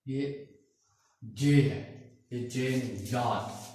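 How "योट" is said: German J said as "याद", with a y sound; German letter name. Shown as a demonstration. Teacher naming the letter J for learners, German pronunciation "याद". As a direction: say "याद" starting with y, not English j. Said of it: "इसका तलफ़ुज़ है German language में".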